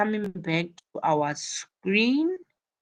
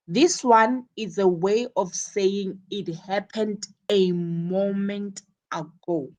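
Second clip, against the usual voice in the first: ordinary talk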